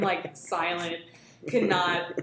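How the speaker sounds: ordinary delivery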